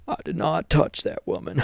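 hammed-up impression of an accented politician's denial; Bill Clinton. Mocking a former president's denial, hammed-up impersonation. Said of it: "Why does he talk like that".

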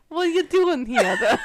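high, loud mock New Jersey, breaking into laughter; New Jersey impression that cracks up; thick New Jersey accent. Host quoting the guy to a Canadian, mock New Jersey accent. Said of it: "with the thickest New Jersey accent".

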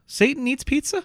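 incredulous question. Retold or imitated. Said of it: "she just goes".

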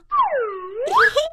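high laugh. Acted. Frog laughs at Pipi's plan.

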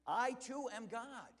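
"I too am God" slow, loud and high, each word weighted; slow emphatic declaration. Acted. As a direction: lay out every word like a proclamation. Climax of sermon.

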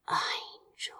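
barely voiced breath of a word. Crow breathes Azra's name in his arms, barely voiced. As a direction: breathe the name out, almost silent. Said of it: "he breathed".

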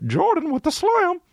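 very high, drawn-out squeal; mock announcer call. Repeats his catchphrase-like call.

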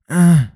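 a loud, high "uh" like an exhausted sleepwalker; imitating girlfriend's groan. Host imitates girlfriend's sleepy groan for listeners, high mock groan. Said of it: "she does this".

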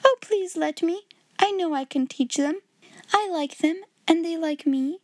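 put-on high, pleading child; eager exclamation. A narrator's reading. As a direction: beg hopefully as a keen little girl. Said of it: "exclaimed Sarah".

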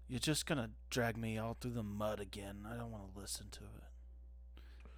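mopey, fading to a whisper; whiny emo teen. Acted. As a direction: sulk it out like an emo kid. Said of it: "[name]'s doing his best impression of an emo".

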